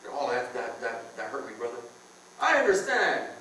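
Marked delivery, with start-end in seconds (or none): 0.1-1.8 mock hurt. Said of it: "little words bother you".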